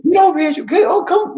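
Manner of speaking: high mock shout at a dog; calling a dog. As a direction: yell like calling an unruly dog.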